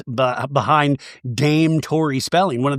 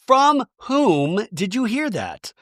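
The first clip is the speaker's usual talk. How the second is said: slow, higher-pitched pointed question; mock interrogation; 7 semitones higher, slower than usual. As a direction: draw it out as a hammed-up demand. Acted reunion-style interrogation.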